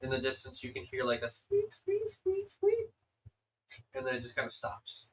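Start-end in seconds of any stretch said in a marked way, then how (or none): 1.2-2.9 high squeaky mouse noises; high squeaks. Acted. GM imitating distant squeaking, high squeaks.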